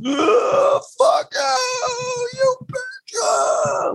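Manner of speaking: imitating a drunk, screaming, sobbing rage at a very high pitch; mock a drunk man's wailing yell, cracked and high. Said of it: "an audio of him drunk going like".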